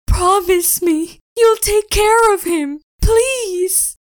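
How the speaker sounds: high, pleading, near tears; desperate plea. Acted. Spirit handing over Poison Berry, begging through tears. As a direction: beg the friend to keep him safe.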